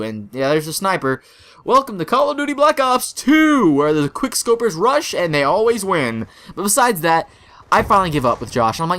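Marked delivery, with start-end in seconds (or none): 1.6-6.3 high-pitched hammed-up game intro; mock game announcer. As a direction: announce it like a video game, playfully. Mock game announcement over rapids.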